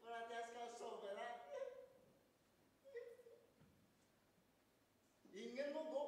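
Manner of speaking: high, insistent possessed voice; possessed command. Acted. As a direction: order them out, pitched well up. Possessed brother orders everyone out.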